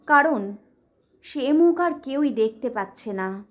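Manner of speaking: usual speaking voice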